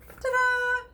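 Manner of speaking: high, loud showy reveal; ta-da for the viewers' fruit tray; excited ta-da. Real, not acted. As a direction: present it like a magic trick.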